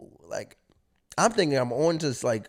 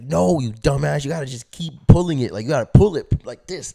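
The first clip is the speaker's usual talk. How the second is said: loud, insistent mock lecture; raised voice, hammering the instructions home; exasperated explaining; 3 semitones higher, 8 dB louder than usual. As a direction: act out a friend losing patience. Friends exasperated with naive kid.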